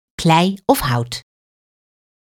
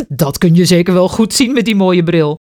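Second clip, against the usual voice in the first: laughing man; 3 semitones higher, 4 dB louder than usual. A narrator's reading. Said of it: "lacht de man".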